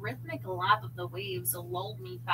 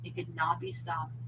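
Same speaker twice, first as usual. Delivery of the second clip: ordinary delivery, nothing marked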